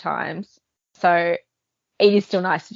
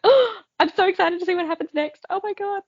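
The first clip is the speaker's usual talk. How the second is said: mock excitement; 14 semitones higher than usual.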